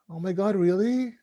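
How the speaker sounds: soft, incredulous delight; mildly amazed, rising through the question; pleasantly surprised. Acted. Wife hearing he's coming along, pleasantly surprised. Wife's delighted reply acted out.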